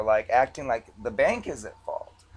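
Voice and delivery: ordinary delivery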